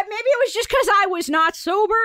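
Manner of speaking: ordinary talk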